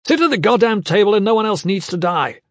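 loud, high, exasperated command; furious roar. A narrator's reading. Gavin, swept into sudden fury, orders the nobles, roaring. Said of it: "he roared".

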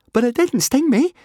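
very high, loud, eager protest. A narrator's reading. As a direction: blurt it out eagerly, high-pitched. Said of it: "Fishlegs said eagerly".

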